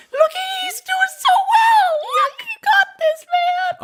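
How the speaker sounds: very high, drawn-out squealed cheer; high-pitched hype man. Pretend. Hype man cheering on the pirate captain, high-pitched squeal. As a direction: squeal encouragement in a silly falsetto. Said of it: "The high-pitched".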